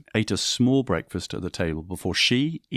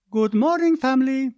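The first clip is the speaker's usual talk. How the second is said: much higher, bright sing-song hello; mother greeting her family at breakfast, cheerful and high; 5 dB louder than usual. Acted. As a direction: sail into the room greeting everyone.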